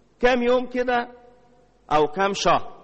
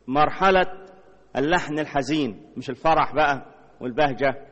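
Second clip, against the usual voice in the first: ordinary delivery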